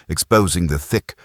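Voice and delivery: plain reading voice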